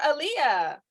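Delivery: usual speaking voice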